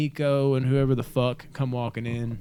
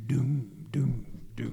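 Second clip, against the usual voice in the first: breathy imitation of a pounding beat; whispered heartbeat doom; 5 dB quieter, slower than usual. Podcaster mimicking the heartbeat music of the score.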